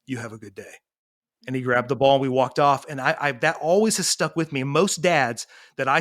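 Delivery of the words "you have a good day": low, soft and level, no anger at all; calm and quiet. Retold or imitated. Dad calmly answering a man who cursed him, soft and quiet. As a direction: let the insult slide off, softly. Dad's calm reply to insult.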